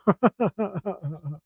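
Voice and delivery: a short real laugh at himself; amused laugh. Real, not acted. Artist laughing at his own shaky lines, amused laugh.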